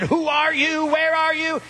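high-pitched, insistent questioning; urgent demand. Retold or imitated. District attorney to an anonymous caller, urgent demand.

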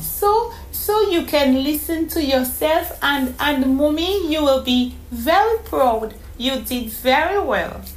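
put-on high, eager child voice; a small girl beaming with pride; proud little girl. A narrator's reading. Donome telling Mommy she did well, proud child voice.

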